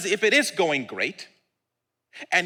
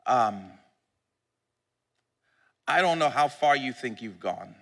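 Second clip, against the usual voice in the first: ordinary talk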